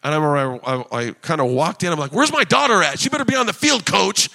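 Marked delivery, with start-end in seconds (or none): 2.1-4.3 high, loud overbearing-dad act; demanding sports dad. Retold or imitated. Dad confronting the coach about his daughter, mock demanding. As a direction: play the pushy parent on the sideline. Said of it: "so I was like that".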